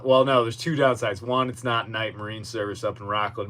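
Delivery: ordinary talk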